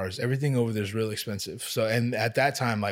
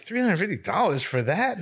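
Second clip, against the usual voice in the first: high, swooping disbelief at the price; retold outraged question; incredulous; 6 semitones higher than usual. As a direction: balk at it, pitch leaping up. Balking at a quoted price.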